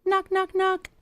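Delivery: high sung knock knock knock; playful high-pitched knock sound; mock knock. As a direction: chirp the knocks like a tap on glass. Imitating knocking on car window.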